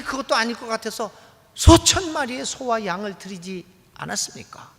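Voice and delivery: loud, high, pleading cry to God; desperate outcry. Acted. Worshippers crying out to God in despair, loud pleading wail. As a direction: cry out to God as if at the end of your rope. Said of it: "이렇게 외치는 거죠".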